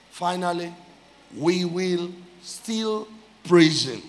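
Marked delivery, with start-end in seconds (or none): 1.4-4.0 very slow, words set apart; slow declaration. Real, not acted. Preacher announcing final praise point, slow weighty declaration. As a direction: hold each word for weight. Slow repeated title phrase.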